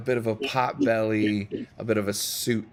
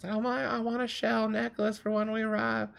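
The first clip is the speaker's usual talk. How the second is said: put-on high excited Southern child's voice; kid's plea in a Southern accent; 13 semitones higher than usual. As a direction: squeal it like a Southern kid begging. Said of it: "I don't know why I'm doing Southern".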